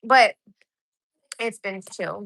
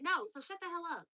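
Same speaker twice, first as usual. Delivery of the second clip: usual speaking voice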